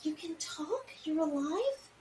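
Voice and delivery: soft, amazed whisper-like; hushed awe. Acted. Player hushed, in character.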